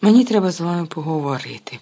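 plain reading voice

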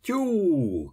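high, drawn hunting whoop; hooting cry. A narrator's reading. Ostap whooping after the runaway, high hoot. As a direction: call it out high, letting it slide down. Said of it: "кричав він".

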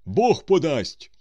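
very loud, high, drawn-out retort; shouted brush-off. A narrator's reading. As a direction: holler a dismissal back at the runner. Passenger shouts back at pleading fugitive.

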